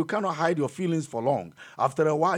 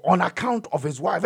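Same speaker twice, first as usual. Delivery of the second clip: ordinary talk, nothing marked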